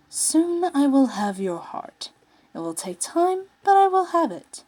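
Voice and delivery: a prince savouring his claim; menacing vow. Acted. Lotor vowing to his captive, slow and menacing. Villain vows to win heart.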